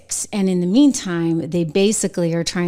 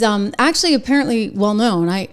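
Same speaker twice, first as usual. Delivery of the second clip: ordinary talk, nothing marked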